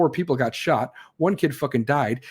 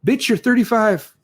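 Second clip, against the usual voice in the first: exasperated retort; 7 semitones higher, 5 dB louder than usual. Acted. Retorting to the imagined slacker.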